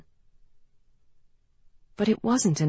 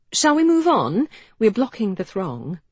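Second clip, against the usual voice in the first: high, louder cheerful brightness; bright; 6 semitones higher, 5 dB louder than usual. Acted. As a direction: brighten up to change the subject. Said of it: "she said brightly".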